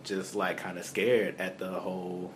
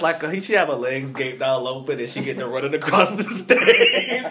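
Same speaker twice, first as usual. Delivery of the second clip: higher, louder, cracking up mid-sentence; laughing while talking; 5 semitones higher, 8 dB louder than usual. Real, not acted. Co-host describing [name] running across the stage, laughing. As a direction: barely get it out through laughter.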